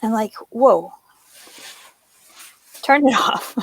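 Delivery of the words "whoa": usual speaking voice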